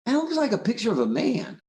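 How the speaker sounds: high-pitched retold realisation; boy's discovery. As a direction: voice a kid puzzling out what he sees. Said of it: "I looked up and I went".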